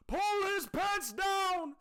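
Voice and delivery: a tiny judge squeaking up high, banging a gavel; high squeaky judge. Pretend. Mock judge calling the baby court to order, high and squeaky.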